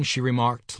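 plain reading voice

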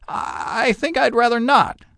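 pleading Scrooge. A narrator's reading. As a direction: beg him not to be harsh. Scrooge reluctant.

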